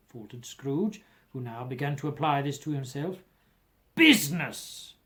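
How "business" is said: loud, slow, anguished cry of "Business"; ghost's cry. A narrator's reading. Ghost wringing its hands, crying out to Scrooge in anguish. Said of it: "cried the ghost".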